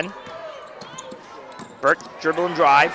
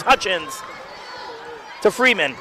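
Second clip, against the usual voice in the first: normal talking voice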